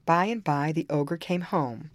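plain reading voice

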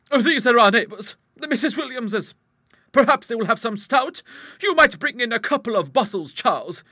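high, loud, assured character voice; big-voiced matter-of-fact lady; strong-willed hostess. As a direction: explain it heartily and without concern. Hostess introduces visitors.

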